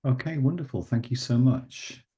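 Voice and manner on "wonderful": ordinary talk, nothing marked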